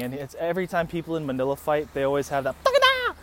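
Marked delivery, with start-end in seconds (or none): 2.6-3.2 high, loud screamed outburst; mock battle cry. Imitating men starting a street fight, mock battle cry. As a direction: yell it as a piercing battle cry. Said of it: "he starts screaming".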